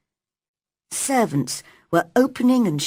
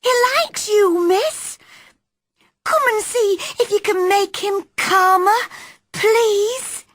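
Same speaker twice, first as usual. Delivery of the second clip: put-on high, louder young maid begging; pleading maid; 4 dB louder than usual. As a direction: coax her to come, urgently.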